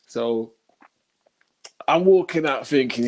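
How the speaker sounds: normal talking voice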